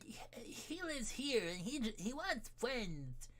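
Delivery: high, far, cutesy little voice; cutesy baby talk. Acted. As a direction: squeak it out like a cute kitten. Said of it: "where Uwu's voice really comes from".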